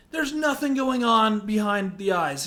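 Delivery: usual speaking voice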